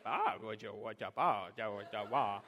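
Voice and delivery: put-on low, babbling baby talk to himself; baby babble. Dad imitating his son babbling to himself in the oven. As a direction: babble like a toddler at his reflection. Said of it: "we call it oven baby".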